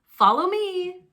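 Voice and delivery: bright mother duck calling out; mama duck calling. Acted. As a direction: call the ducklings to follow. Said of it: "Mama Duck called to her brood".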